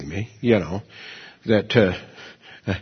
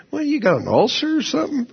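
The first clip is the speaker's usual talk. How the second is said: much higher, teasing incredulous voice; mocking friend; 13 semitones higher, faster than usual. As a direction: needle him like the old drinking buddy. Re-quoting friend's mocking question.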